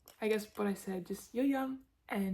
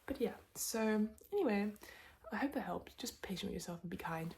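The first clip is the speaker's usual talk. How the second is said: usual speaking voice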